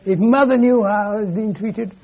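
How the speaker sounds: high, wailing mock-tearful complaint; mock crying. Wife crying at her husband to get her own way, mock crying. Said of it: "so they cry and say".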